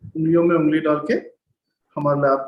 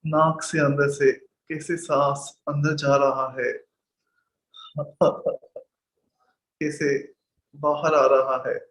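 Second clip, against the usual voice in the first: slow, measured meditation guide; guided meditation; slower than usual. Real, not acted. Teacher guiding students to sit at ease with the breath, slow meditation voice. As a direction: lead them into stillness, drawing out words between long silences.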